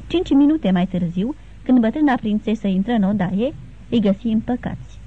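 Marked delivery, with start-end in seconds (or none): none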